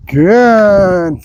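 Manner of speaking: long, stretched-out praise word; duration marker. Real, not acted. Trainer telling dog to keep waiting, drawn-out marker. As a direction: hold the word out slowly, letting it fall. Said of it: "accenting the good duration marker".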